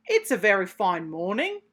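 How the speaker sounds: a lightly voiced, pleased drake; pompous drake. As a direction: puff up like a self-satisfied duck. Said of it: "said Mister Drake Puddle Duck".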